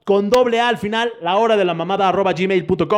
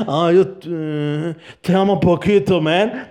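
put-on boyfriend voice; 3 semitones lower, slower than usual. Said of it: "Soy el anciano verguero".

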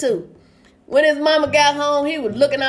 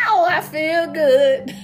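drawn-out soul singer's cry; 3 semitones higher, slower than usual. Retold or imitated. Man gives in to the lady, drawn-out showman's cry. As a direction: stretch it out like a showman's catchphrase.

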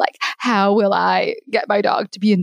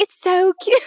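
a New Zealand accent attempt that slides into British, pitched much higher; 11 semitones higher than usual. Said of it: "Try, try to do an accent".

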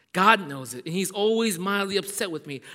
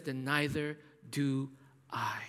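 preacher whispering Jesus' forgiveness; whispered mercy; 4 semitones lower, 6 dB quieter, slower than usual. Acted. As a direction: drop to a tender whisper. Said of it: "And Jesus says".

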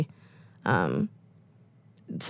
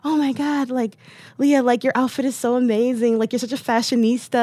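high, gushing praise from the mosque crowd; gushing friends; 7 semitones higher than usual. Retold or imitated. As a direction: squeal it like admiring friends. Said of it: "everyone's like".

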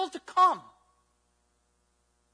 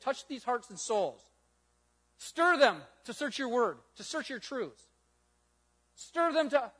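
low, reverent prayer voice; 6 semitones lower than usual. Acted. Closing prayer.

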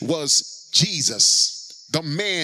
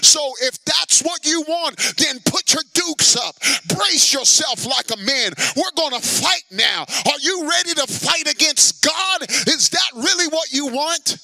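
loud, high, fierce preaching as God; God challenging Job; 8 semitones higher, 3 dB louder than usual. Acted. Preacher voicing God daring Job to fight.

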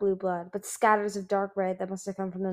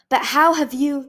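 louder, higher startled start; 6 semitones higher, 9 dB louder than usual. Acted. Winter starting to ask, startled. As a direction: begin the question in alarm.

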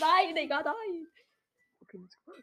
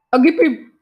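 high, much louder hype yelling; battle cheer; 10 semitones higher, 17 dB louder, slower than usual. Real, not acted. Gamer hyping himself up mid-fight with loud cheers. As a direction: roar yourself on as you attack.

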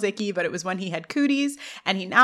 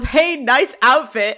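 louder, drawn-out mock heckle; retold yell; 3 semitones higher, 5 dB louder, slower than usual. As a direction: call it out like a heckler in the crowd. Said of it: "Someone from the audience yells".

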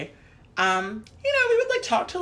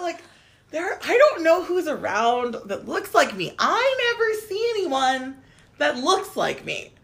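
normal talking voice

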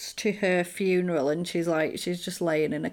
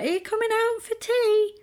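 much higher sing-song wife voice; high, mock-sweet wife calling her husband; nagging wife. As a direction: call him out from the other side of the door. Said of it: "And then she's like".

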